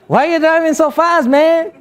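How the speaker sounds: friend's reply. Retold or imitated. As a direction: toss it up high, teasing. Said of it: "He said".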